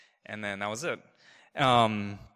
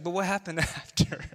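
higher-pitched prodding question with a laugh; playful imitation of mom digging, pitched up; imitating prodding mom; 7 semitones higher than usual. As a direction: laugh through a mock nudge for the confession. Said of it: "she just kept on prodding me".

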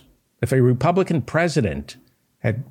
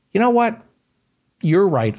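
a sudden loud burst, pitched way up; retold shout; 10 semitones higher, 4 dB louder than usual. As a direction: yell it out, fed up at the pharmacy counter. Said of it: "And I finally shouted".

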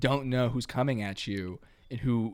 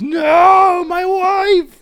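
much higher, loud anguished scream; 15 dB louder, much slower than usual. Acted. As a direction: wail it out. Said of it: "the cabbie just screams".

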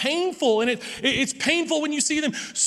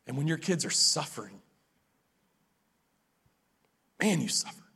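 hushed reflective preaching; 6 semitones lower, 9 dB quieter than usual. Acted. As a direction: let each line land quietly. Slows to a hushed reflective passage.

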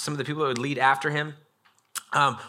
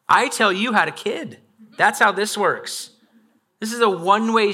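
higher, louder mock-bossy parent; stern parent; 7 semitones higher, 4 dB louder than usual. Parent scolding the kid who talks back, mock-stern and loud. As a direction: lay down the law like an exasperated parent.

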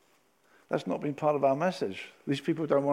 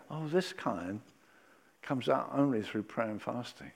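soft explanation; 5 semitones lower, 8 dB quieter than usual. Acted. Jesus answering, soft.

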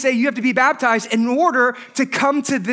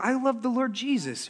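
put-on baby; 9 dB quieter than usual. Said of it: "So when you're a baby".

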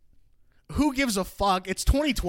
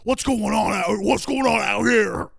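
loud, raised put-on dad voice; dad's voice; 4 semitones higher, 6 dB louder than usual. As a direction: imitate a dad barging in, stretching the end. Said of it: "He said it in that voice".

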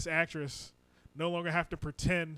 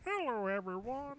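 high, slow frog puppet voice; Kermit the Frog; 7 semitones higher, 5 dB quieter, slower than usual. Acted. Mocking a co-host's impression, high frog puppet voice. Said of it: "It's more Kermit the Froggy".

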